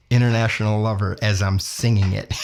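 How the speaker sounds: ordinary talk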